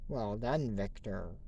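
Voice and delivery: a low villain purr. Pretend. Recapping the cartoon to viewers, deep villain voice. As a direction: say it like a sly villain boss. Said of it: "Did you hear the voice I just did?".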